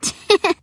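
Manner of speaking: short, high titter; embarrassed bird titter. A narrator's reading. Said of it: "sounds like an embarrassed bird when she titters".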